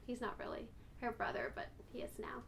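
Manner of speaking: breathy whispered aside, barely voiced. Real, not acted. Owner whispers an aside about the dog's brother. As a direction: drop to a secret whisper for the joke.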